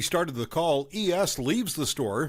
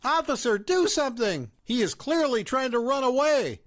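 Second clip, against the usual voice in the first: shouting; 9 semitones higher than usual. A narrator's reading. Mother grabbing the narrator, shouting at the officer. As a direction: cry out to the cop in alarm. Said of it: "grabs me shouting".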